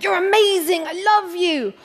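high, gushing over-the-top affection; gushing passionate. Retold or imitated. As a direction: gush at them like an overexcited fan. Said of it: "really passionate".

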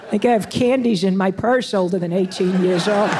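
teasing, laughing through words. Acted. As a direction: playfully tease the young man. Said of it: "I'm teasing you".

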